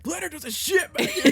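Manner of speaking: high, loud mock scream; imitating panicked yelling. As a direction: yell for the boss in comic alarm. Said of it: "Why are you yelling?".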